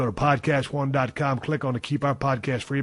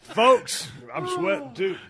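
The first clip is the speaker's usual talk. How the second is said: flustered laughing; 8 semitones higher, 6 dB louder, slower than usual. Real, not acted.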